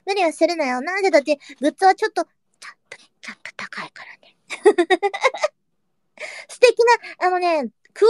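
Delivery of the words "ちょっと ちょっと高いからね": confidential whisper, barely voiced; hushed whisper. Real, not acted. Streamer admits goods are pricey, hushed whisper. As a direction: whisper it like an awkward secret. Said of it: "ひそひそ助かる".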